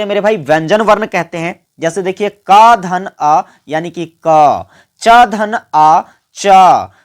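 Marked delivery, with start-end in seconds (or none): 1.8-4.7 क धन अ spelled out slowly, then क said whole; slow sound-building demo. Shown as a demonstration. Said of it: "देखिए उच्चारण पर ध्यान दीजिए". 5.0-6.9 च धन अ drawn out, each sound apart, then च said whole; very slow syllable demo. Shown as a demonstration. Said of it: "देखिए उच्चारण पर ध्यान दीजिए".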